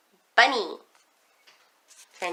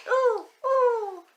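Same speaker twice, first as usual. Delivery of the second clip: animal call; 13 semitones higher than usual. Shown as a demonstration.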